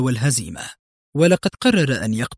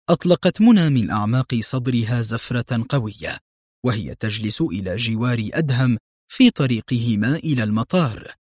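usual speaking voice

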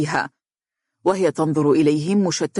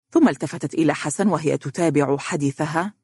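plain reading voice

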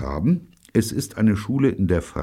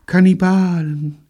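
dreamy; 8 dB louder than usual. A narrator's reading. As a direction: let the word float out like a daydream. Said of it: "sagte Evelyn träumerisch". (träumerisch, hoch und gedehnt)

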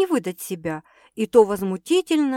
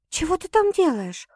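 mild surprise, voice lifted higher; surprised; 8 semitones higher than usual. A narrator's reading. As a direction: ask in puzzled surprise. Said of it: "удивилась Саша". (с лёгким удивлением)

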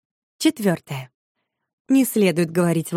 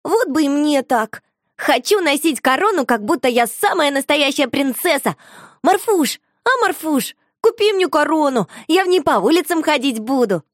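put-on high, loud, wheedling child; begging little girl; 8 semitones higher, 4 dB louder than usual. A narrator's reading. Shusha begs Marfusha for a crown, high and wheedling. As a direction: whine and plead for a crown.

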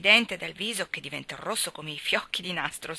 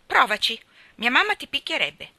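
loud, raised defiant dare; exclaiming; 6 semitones higher, 7 dB louder than usual. A narrator's reading. Ivy threatens Gerald with her mother, exclaiming defiantly. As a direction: dare them, voice up. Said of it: "esclamò Ivy".

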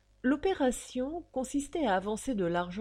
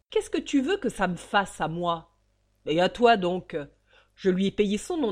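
harsh defiance; 5 dB louder than usual. Acted. Father coldly defiant.

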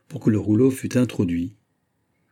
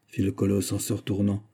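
ordinary delivery

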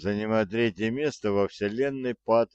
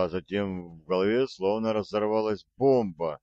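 plain reading voice

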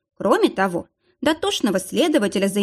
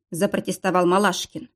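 usual speaking voice

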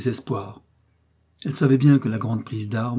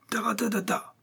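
very high, softer bugle-call noise; imitated trumpet; 11 semitones higher, 5 dB quieter than usual. A narrator's reading. Boy playing at war, imitating a trumpet. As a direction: toot a trumpet call with the mouth. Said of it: "le son du tambour et de la trompette".

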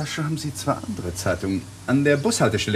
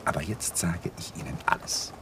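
whispered surrender; 5 semitones lower, 7 dB quieter than usual. Acted. Giving in under questioning.

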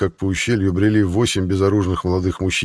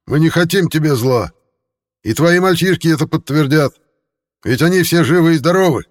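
higher, louder calling out; shouting; 10 semitones higher, 5 dB louder than usual. A narrator's reading. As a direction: shout reassurance over the fence. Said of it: "крикнул в ответ Курт". (громкий крик)

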